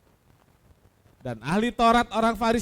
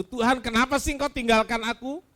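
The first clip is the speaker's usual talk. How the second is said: raised, pleading cry; calling out to God; 7 semitones higher, 4 dB louder than usual. Acted. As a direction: call out, louder and higher at the end. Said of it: "dengan berseru".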